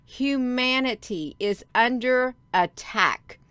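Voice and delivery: "Humanity is under attack" said slowly, each word weighted; emphatic stress. Real, not acted. Streamer warning viewers humanity is in danger, emphatic stress. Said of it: "I can't stress it enough".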